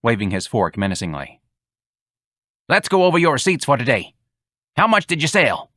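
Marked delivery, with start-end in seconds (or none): 2.7-5.7 higher, businesslike barking; brusque boss. Acted. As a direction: demand the numbers impatiently. Clyde voiced, raised.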